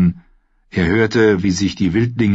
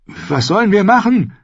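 high-pitched, urgent called question; calling out; 12 semitones higher than usual. A narrator's reading. Satin calling to John amid the attack, high urgent call. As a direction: call it out high, over the noise of battle. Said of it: "rief Satin".

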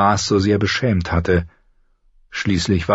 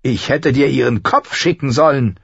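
raised, threatening royal outburst; furious king, pitched up; angry growl; 7 semitones higher, 3 dB louder than usual. A narrator's reading. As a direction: assert your rank in anger. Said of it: "knurrte Viserys".

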